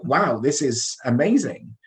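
ordinary talk

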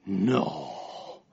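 low, soft deadpan No; mock deep friend saying No; imitating Henry. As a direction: drop deep and quiet for his No. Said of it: "that's him saying that".

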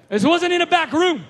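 loud, high preaching burst; preaching shout. Real, not acted. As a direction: hammer it home to the skeptics. Preacher stressing to skeptics.

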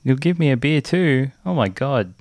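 ordinary talk

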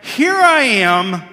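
slow, stretched indignant opening; a high, drawn-out huff of outrage; mock-indignant protest, said slowly; indignant outburst. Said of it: "I remember being angry".

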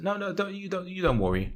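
put-on high, soft go at a German accent; attempted German accent. Trying a German accent for the co-host, attempted German accent. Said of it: "I'll try and do a German accent".

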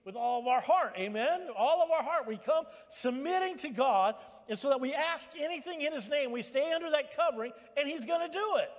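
high, loud pulpit exhortation; full-force preaching. Acted. Preacher urging the congregation to submit to God, full-force preaching.

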